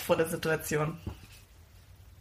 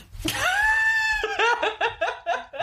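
giddy laugh. Real, not acted. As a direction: burst out laughing with joy. Gift reaction, big laugh.

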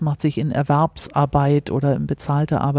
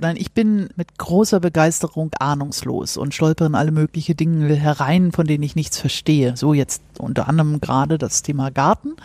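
usual speaking voice

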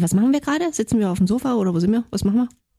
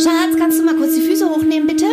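louder, higher call over the vacuum; yelling across the room; 6 semitones higher, 5 dB louder than usual. Acted. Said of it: "also nochmal".

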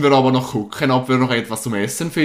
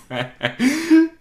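real laugh jumping up high; sudden high giggle fit; high burst of laughter; 3 dB louder than usual. Real, not acted. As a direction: break into a squeaky real laugh. Bursts out laughing after joke.

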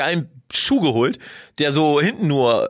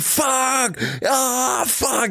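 retold scream; 9 semitones higher, much slower than usual. Patient cursing as the doctor squeezes, retold mock scream. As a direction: act out screaming curses without full volume. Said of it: "ich hab geschrien".

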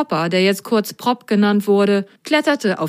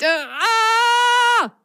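high-pitched scream. Acted. Milo cut off by whirlpool.

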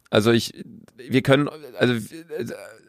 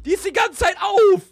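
urgent high plea; 11 semitones higher than usual. Real, not acted. Urges to close the door.

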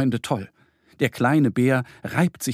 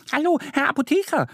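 very high, fast duck voice greeting; excited duckling; 14 semitones higher, faster than usual. A narrator's reading. Duck Annie greets the pharmacist, very high fast. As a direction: flutter up eagerly and say hello. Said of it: "begrüßt sie ihn".

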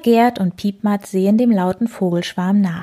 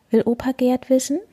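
ordinary delivery

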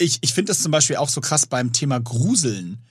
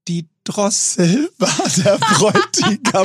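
laughing punchline; 5 semitones higher, slower than usual. Retold or imitated. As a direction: draw out the reveal, laughing through it.

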